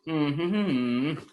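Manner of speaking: high, long wordless pleading hum; hummed plea. Shown as a demonstration. Teacher humming the tune of a plea, hummed pleading. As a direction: hum the begging melody, stretched out.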